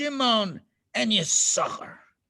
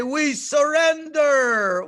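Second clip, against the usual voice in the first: louder and higher, drawn-out surrender cry; "surrender" shouted out slowly and desperately; 8 semitones higher, 9 dB louder, much slower than usual. Acted.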